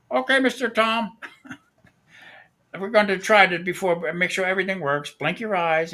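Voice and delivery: imitating the Indian technician. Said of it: "he didn't speak too good of English".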